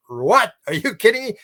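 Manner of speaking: high, loud disbelief; astonished what. Retold or imitated. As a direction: react like you can't believe it. Speaker retells his own amazement.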